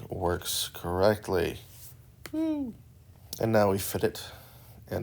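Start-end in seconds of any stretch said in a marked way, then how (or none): 2.3-2.8 high little cheer of delight; happy woo. Real, not acted. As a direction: let out a quick pleased woo. Cheering own fitting work.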